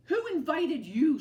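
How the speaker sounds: loud rude challenge; grumpy. A narrator's reading. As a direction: snap it at the lizard. Hank grumpy to lizard.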